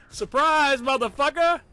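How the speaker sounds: high, loud shout breaking into laughter; spring the surprise, then crack up; gleeful shout. Real, not acted. Ambush on screen, bursts out laughing.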